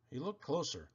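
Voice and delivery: plain reading voice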